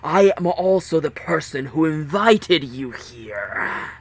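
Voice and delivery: slow, harsh masked villain; menacing announcement from a speaker; harsh captain voice. Acted. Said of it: "The voice was harsh".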